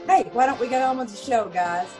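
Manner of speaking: ordinary talk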